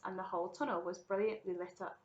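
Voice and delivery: plain reading voice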